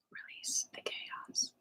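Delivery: slow whispered meditation cues; whispering. Acted. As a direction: breathe every word, barely voiced.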